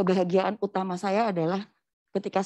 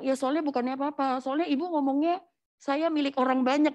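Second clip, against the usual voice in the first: higher-pitched imitated explanation; retold objection; 6 semitones higher than usual. Husband explaining his worry to wife, mild retold objection. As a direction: protest mildly in a raised pitch.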